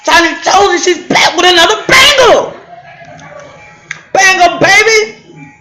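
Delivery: high and loud hype; excited hype intro. Real, not acted. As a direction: hype up the crowd. Hyping her TikTok intro.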